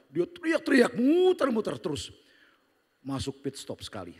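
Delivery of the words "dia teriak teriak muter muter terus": excited telling. Real, not acted. Describing the wild ride.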